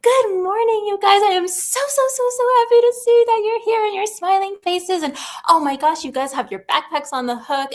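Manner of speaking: high, loud, gushing good morning; excited teacher greeting. Retold or imitated. Teacher greeting grade ones in the morning, bubbling over with excitement. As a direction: greet the class like it's the best day ever. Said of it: "I was just more excited".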